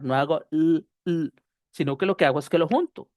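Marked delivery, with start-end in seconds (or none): 0.5-1.3 isolated L sound, "l", said twice; two separate L's. Shown as a demonstration. Teacher showing the doubled sound to avoid, separate L's. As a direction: sound out "l", pause, "l" as two consonants. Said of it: "no hago dos consonantes".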